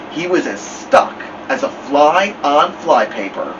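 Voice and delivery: ordinary delivery, nothing marked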